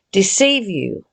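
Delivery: ordinary talk, nothing marked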